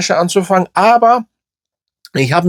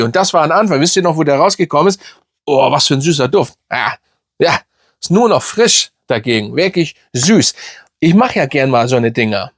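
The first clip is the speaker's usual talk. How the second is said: ordinary talk, nothing marked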